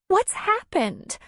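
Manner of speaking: high, pleading, close to crying; tearful pleading. A narrator's reading. Darla pleading with Tom, tearful and high. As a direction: beg him to explain. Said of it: "Her eyes filled with tears".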